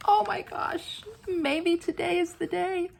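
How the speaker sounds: high, thin excited voice; hopeful excitement. Real, not acted. Crafter hoping to win her crown, high excited voice. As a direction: say it high with giddy hope.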